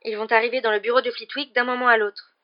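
urgent warning. Acted.

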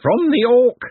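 high, loud screech; screeching bird creature. A narrator's reading. Bird creature correcting Glenda about the danger, screeching. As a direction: screech it as a dire warning.

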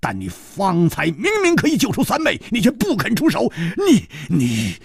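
high, rapid, indignant protest; angry outburst. Acted. Song Gang reproaching the killer who would not help, angry outburst. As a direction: rage that ends in lost words.

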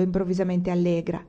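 usual speaking voice

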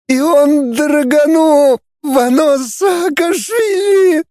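very high, long drawn-out scream; slow tearful falsetto shriek; agonized howl. A narrator's reading. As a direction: wail each name out in agony. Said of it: "взвыл лже-Фанас".

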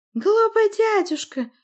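very high, slow, loud screech; squealed taunt. A narrator's reading. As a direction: squeal it, drawn out and scornful. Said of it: "завизжала она".

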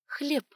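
"Хлеб" crisp and high; clearly. A narrator's reading. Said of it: "чётко произнесла".

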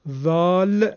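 "ذال" with voiced th as in this; tongue tip at the teeth for "ذال"; Arabic letter name. Shown as a demonstration. Teacher lists special Arabic letters, "ذال" with voiced th. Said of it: "اون ده حرف عبارتند از".

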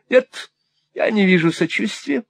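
high, self-pitying whimper. A narrator's reading. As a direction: whine for sympathy in a high voice. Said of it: "захныкала миссис Никльби".

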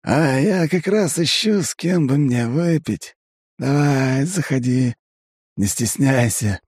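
high, slow, cheery tipsy elf; a jovial drunk elf beckoning in; Aiven. A narrator's reading. Elf Aiven invites him to drink.

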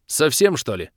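indignant clarification; irritated. A narrator's reading. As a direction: correct her crossly. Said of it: "озлился я".

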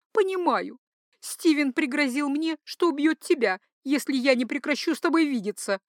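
high-pitched duchess confiding a threat; lovestruck duchess, distressed. Acted. Duchess tells her lover of her husband's threat, high and distressed. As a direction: play the distressed mistress in a high voice. Heroine's voice in narrated story.

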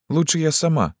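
put-on high, small girlish voice; shy girl. A narrator's reading. Embarrassed Lyuska would undress herself, shy. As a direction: say it high without pushing.

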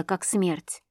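ordinary delivery, nothing marked

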